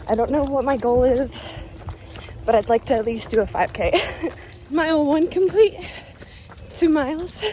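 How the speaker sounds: breathless talk mid-jog; panting between words on a run; while running. Real, not acted. Talking mid-run, measures raised.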